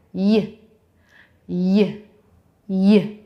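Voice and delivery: "Y" said as a short "yuh"; Y sound. Shown as a demonstration. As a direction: give each "Y" as a separate "yuh". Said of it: "And it makes the Y sound".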